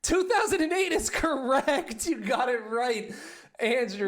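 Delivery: high, bright answer confirmation; game show host. Acted.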